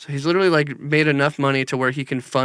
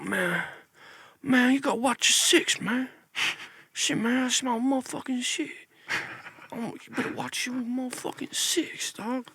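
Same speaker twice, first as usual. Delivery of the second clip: higher and softer, a mock streetwise old retired man; Seagal's old-man voice; 9 semitones higher, 8 dB quieter than usual.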